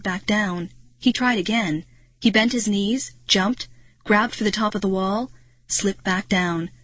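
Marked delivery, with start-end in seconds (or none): none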